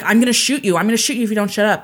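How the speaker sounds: urgent imitated threat, speeding up; retold threat. Podcaster quoting gunman at screaming woman, urgent retold threat. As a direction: raise pitch and volume, then rush the repeat.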